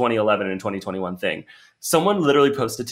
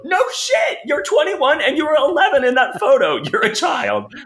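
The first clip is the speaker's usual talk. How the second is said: much higher, louder, squealing disbelief; shrieking exasperation; 4 dB louder than usual. Real, not acted. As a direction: squeal it like you can't believe people. Exasperated rant about photos.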